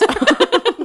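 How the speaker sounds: high burst of laughter; sudden high giggle. Real, not acted. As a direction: let a real laugh burst out. Laughing at the possibility.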